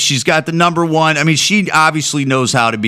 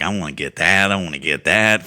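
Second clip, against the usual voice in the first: salivating; 8 semitones lower, 4 dB quieter than usual. Retold or imitated.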